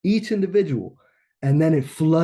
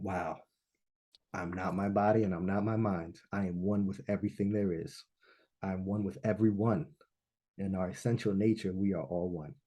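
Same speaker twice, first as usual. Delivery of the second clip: much softer and lower, hushed wonder; soft, hushed revelation; awed hush; 6 semitones lower, 8 dB quieter than usual. Retold or imitated. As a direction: breathe it out in quiet awe. Awed realization after the trip.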